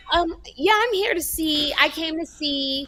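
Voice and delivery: high-pitched mock girl voice; imitating Sandy. Storyteller mimics the girl at the show, high mock voice. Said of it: "Why'd she talk like that?".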